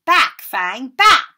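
loud, rising command to the dog; ringing shout. Acted. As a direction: holler the dog back from the door. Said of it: "Hagrid's voice rang out".

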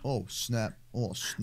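low, soft muttered reaction; hushed dismay. Real, not acted. Gamer seeing trouble coming, hushed dismay. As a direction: mutter it under your breath.

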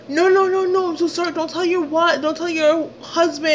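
high-pitched mock pleading; panicked begging. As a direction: imitate him begging in a high voice. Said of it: "he's apologizing".

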